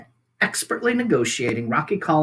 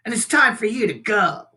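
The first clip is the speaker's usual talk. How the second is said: mild mock growl, higher; 8 semitones higher, 4 dB louder than usual. A narrator's reading. Thorn backing his sister, mild growl. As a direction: brother bear threatening. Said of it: "growled Thorn".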